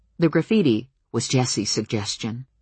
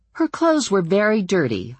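smug; 7 semitones higher than usual. A narrator's reading. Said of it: "a little smugly".

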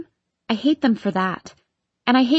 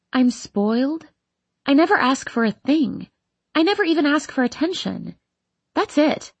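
ordinary delivery

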